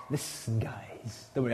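low, hushed serpent hiss of a voice; snaky voice. Retold or imitated. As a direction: slither the words like a snake. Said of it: "in his sort of snaky sort of voice".

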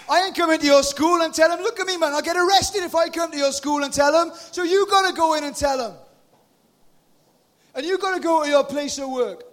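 loud, high, impassioned preaching; rally the crowd from the pulpit; preaching at full force. Acted. Preacher fires up the congregation, loud and impassioned.

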